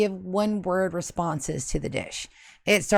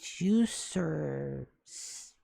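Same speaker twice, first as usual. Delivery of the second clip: whispering; 5 semitones lower, 10 dB quieter, much slower than usual. Real, not acted. Whispered aside.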